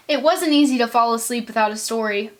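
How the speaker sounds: usual speaking voice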